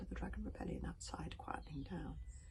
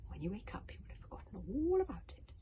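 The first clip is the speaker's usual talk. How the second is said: gentle, breathy lullaby tone; higher, soothing suggestion; hypnotic old dragon; 4 semitones higher, 5 dB louder than usual. A narrator's reading. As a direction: lull into forgetting. Wodensfang lulls Toothless to sleep.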